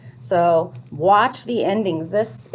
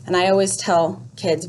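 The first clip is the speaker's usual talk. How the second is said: ordinary delivery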